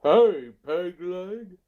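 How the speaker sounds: slow, loud, high cartoon drawl; Hank Hill voice. Clip guy calling out as Hank Hill. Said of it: "that's his Hank Hill".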